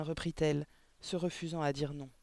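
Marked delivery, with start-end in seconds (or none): none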